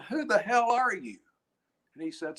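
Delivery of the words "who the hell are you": higher-pitched retold challenge; astonished tower guy. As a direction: lightly imitate a guy demanding an answer. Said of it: "The guy said".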